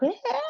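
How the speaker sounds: very high-pitched mock scream; imitated scream. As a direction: mimic a shrill creature scream. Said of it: "they'd be screaming like".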